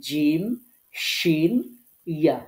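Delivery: জিম, শিন, ইয়া pronounced firmly from mid-tongue on the palate; firm, deliberate Arabic letter names জিম, শিন, ইয়া; pressed articulation. Shown as a demonstration. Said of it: "pressure দিয়ে এই তিনটা হরফ উচ্চারণ".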